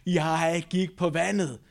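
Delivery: very slow, stretched boast; playful show-off emphasis; bragging. Acted. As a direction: draw it out proudly for the doubters. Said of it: "han kunne blære sig med".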